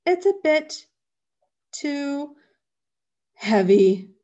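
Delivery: strained, word by word with long gaps; haltingly, as if straining under a weight; grunting with effort. A narrator's reading. Bear hugging a huge heavy thing, straining. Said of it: "grunted Douglas".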